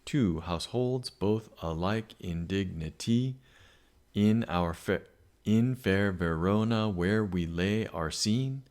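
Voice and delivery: slow, measured verse reading; spaced-out verse with each syllable marked; counting syllables. Shown as a demonstration. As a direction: recite slowly, syllable by syllable. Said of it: "I'm gonna count my syllables here".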